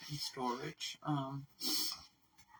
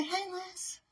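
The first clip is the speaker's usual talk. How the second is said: much higher, cooing hey to the pet; squeaky greeting to dog. Pretend. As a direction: coo a hello for the little dog.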